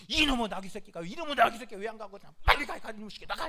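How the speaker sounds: loud, furious scolding of a stubborn animal; acted-out angry yell, driving the donkey on; angry Balaam. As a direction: shout it like an enraged master. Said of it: "이 발람이 화가 나가지고 막".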